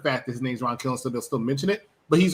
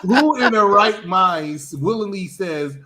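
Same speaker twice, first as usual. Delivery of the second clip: incredulous rant; 5 semitones higher, 5 dB louder, much slower than usual. Real, not acted. Ranting against Kentucky.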